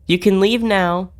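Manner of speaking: usual speaking voice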